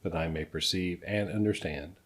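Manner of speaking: soft, measured reading of a prayer; prayer recitation. Acted. Recites Reverend Bradley's request as prayer.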